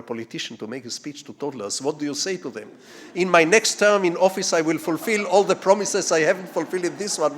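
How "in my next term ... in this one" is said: mock politician's promise. Imagined politician's promise to toddlers.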